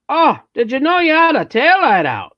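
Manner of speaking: high, loud, over-exaggerated Minnesota accent. Shown as a demonstration. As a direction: try to hold a thick Minnesota accent. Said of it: "but, like, to hold the accent".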